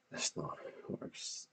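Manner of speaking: dry whispered correction; whispered aside. Real, not acted. Correcting the creator under his breath, whispered. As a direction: say it under your breath, unvoiced.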